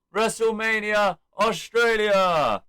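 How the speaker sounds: slow, high, drawn-out ring call; showman's stretched-out holler; imitated announcement. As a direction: proclaim it to the arena. Acts out Waller's big announcement.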